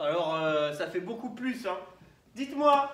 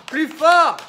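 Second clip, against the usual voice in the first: loud; 4 semitones higher, 10 dB louder than usual. Real, not acted. Calls for louder applause.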